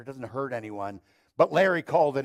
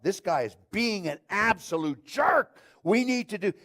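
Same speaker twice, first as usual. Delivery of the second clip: louder, higher, slowed down with exasperation; exasperated outburst; 8 semitones higher, 4 dB louder than usual. Retold or imitated. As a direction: spit it out like you're fed up with the guy. Andy Stanley quoting his own frustration.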